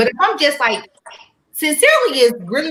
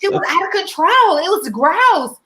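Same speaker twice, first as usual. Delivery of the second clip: mock disgust; 6 semitones higher than usual. Retelling Lucy's gross behavior, mock.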